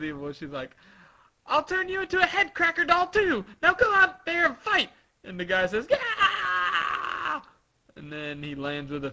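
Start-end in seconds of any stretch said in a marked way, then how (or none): 1.5-4.9 high, fast villainous threat; evil Sugar. Acted. Evil Sugar commanding her servant, high angry order. 5.9-7.4 high, drawn-out acted scream. Servant turned into a toy, long high scream.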